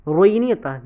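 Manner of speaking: ordinary talk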